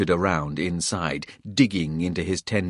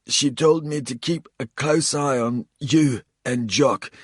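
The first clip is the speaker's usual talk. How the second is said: higher, strained voice of a bleeding traitor; wounded Coles; 6 semitones higher, 4 dB louder than usual. A narrator's reading. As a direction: spit it out pained and defiant. Narrator voices confessing Coles.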